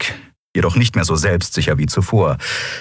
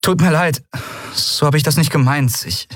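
remorseful; 9 semitones higher than usual. A narrator's reading. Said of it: "tiefe Reue überkam sie".

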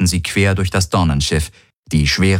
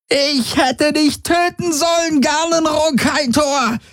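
very high, slow, drawn-out screech; furious screeching; slower than usual. Acted. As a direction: screech the threat at your captor. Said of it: "kreischte sie". (hasserfüllt kreischend, sehr hoch)

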